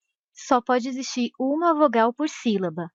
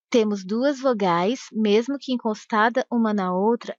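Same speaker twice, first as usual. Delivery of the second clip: ordinary talk, nothing marked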